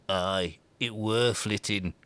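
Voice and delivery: low, slow, eerie hammed-up Yorkshire voice; a lightly mocked Yorkshire creature calling from nowhere; mock Yorkshire hob. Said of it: "she hears the voice from somewhere else say".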